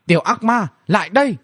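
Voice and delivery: high, commanding shout; calling out high from a rooftop; narrated loud call. A narrator's reading. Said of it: "mà thét lớn".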